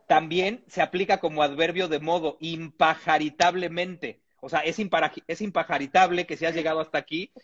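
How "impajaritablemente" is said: IMPAJARITABLEMENTE drawn out slowly; impajaritablemente, unhurried syllable by syllable; slow presentation. Shown as a demonstration. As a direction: spell out impajaritablemente with a slow, measured delivery. Shows the word's adverb form.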